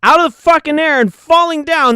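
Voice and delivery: loud, rising, angry rant; furious rant. Real, not acted. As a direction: hammer it out in a fury. Ranting about falling tree trunks.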